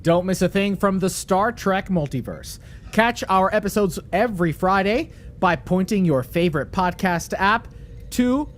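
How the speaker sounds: projected host read; podcast promo read. Acted. Host plugging the weekly episodes to listeners, promo read. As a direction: read it out as a lively plug. Reads closing script.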